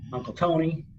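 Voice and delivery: put-on light Sopranos-style Italian-American accent. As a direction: say it like a Jersey mob uncle, Italian accent. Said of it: "drop into the Italian accent".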